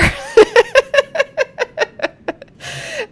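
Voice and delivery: big squealing laugh; real high laughter; high laugh burst. Real, not acted. Laughing at meme.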